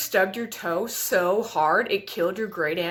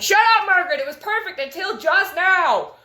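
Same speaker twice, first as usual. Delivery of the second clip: high, loud snapping at the dog; annoyed snap; 8 semitones higher, 7 dB louder than usual. Pretend. Snapping at the dog Margaret for ruining the moment, loud and higher. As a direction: shut the dog up, irritated. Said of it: "And you're like".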